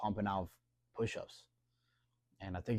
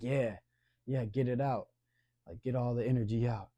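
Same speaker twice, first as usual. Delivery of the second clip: louder, higher, pumped-up cheering; hyped encouragement; 4 semitones higher, 7 dB louder than usual. Retold or imitated. Shabazz cheering on rowdy kids to burn off energy, hyped and loud. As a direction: egg them on to burn off energy.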